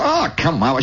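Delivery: acted boyish reluctance; protesting kid. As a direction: balk at mom's order. Kid balks at mother's order.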